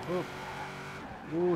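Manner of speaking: ordinary talk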